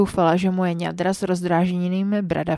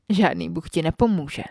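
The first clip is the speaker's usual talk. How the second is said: plain reading voice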